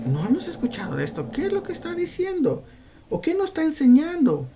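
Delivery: amazed crowd. Acted. Preacher voicing the astonished synagogue crowd, a touch surprised. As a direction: sound baffled by the new teaching. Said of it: "discutían entre sí diciendo".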